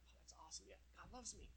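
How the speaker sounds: soft, whispered delighted reply; gullible whisper. Pretend. Tempted listener answers the devil, soft whispered delight. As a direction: whisper like you're happily fooled.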